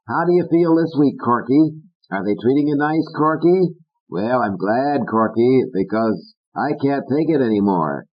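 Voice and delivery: mock Peg. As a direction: parody her gentle visits. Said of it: "mouth words like".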